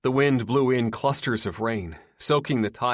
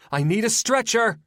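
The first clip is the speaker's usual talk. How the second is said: shouting; 14 semitones higher, 6 dB louder than usual. A narrator's reading. Nurse calls for help in chaos.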